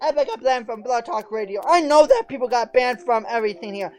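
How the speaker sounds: fiery preaching. Acted. Host blasting the trolls to his chat, fiery preacher rant. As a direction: thunder it from the pulpit.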